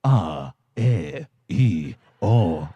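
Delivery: put-on little boy doing a deep voiceover voice; deep announcer voice. Shown as a demonstration. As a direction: recite vowels like a radio announcer. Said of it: "I probably sounded like this".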